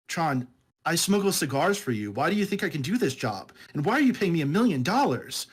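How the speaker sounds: lightly voiced audiobook heroine; Rosario Dawson reading Jazz. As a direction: close to his own voice, a calm, even read. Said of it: "the way I would hear her voice".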